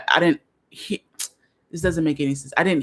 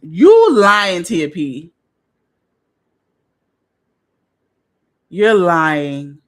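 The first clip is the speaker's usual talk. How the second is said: loud outraged you lying; much louder, accusing and incredulous; disbelieving shout; 9 dB louder, much slower than usual. Real, not acted. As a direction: shout in playful disbelief at the chat. Reacting to a reader comment.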